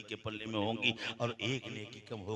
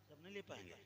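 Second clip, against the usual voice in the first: quiet; 4 semitones higher, 16 dB quieter, faster than usual. Acted. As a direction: say it gently to yourself. Quiet plain repeat.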